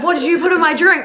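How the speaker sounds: a hint of an outraged drunk; mock indignant passenger. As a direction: lightly voice the rattled passenger. Retelling drunk passenger's accusation.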